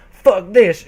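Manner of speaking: loud, sharp yell. Acted. Applejack yells at herself in frustration, loud. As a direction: throw it out in defiance. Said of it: "she yelled".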